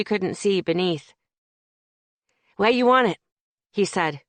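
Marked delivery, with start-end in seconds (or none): 2.6-3.2 put-on breathless Brooklyn kid, a touch louder; puffing out the words in a Brooklyn accent; winded Brooklyn accent. Delivery kid out of breath from heavy package, Brooklyn accent. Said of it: "in a winded Brooklyn accent".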